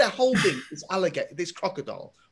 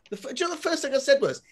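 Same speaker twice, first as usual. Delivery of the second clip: usual speaking voice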